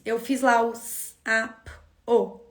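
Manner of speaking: s, a, p, o slow and separate; sounding out phonemes. Shown as a demonstration. Teacher sounding out sapo letter by letter. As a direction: sound out each letter slowly. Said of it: "o que que eu fiz".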